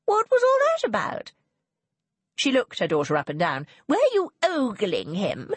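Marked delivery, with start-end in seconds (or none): 0.0-1.3 very high, baffled mother; perplexed. A narrator's reading. Mother left staring after him, high-pitched perplexed question. 3.9-5.5 slow, higher, suspicious mother; accusing. A narrator's reading. Mother looks daughter up and down, slow accusing question.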